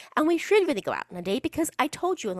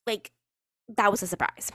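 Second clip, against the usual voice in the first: normal talking voice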